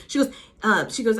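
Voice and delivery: usual speaking voice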